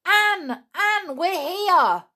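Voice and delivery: put-on trapped children calling out to Anne, loud. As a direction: shout for her to hear.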